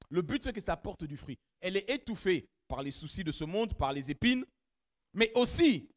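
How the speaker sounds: ordinary talk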